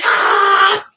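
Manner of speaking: high battle cry; fighting yell. Retold or imitated. Lunging at the man again, battle yell. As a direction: let out a charging yell.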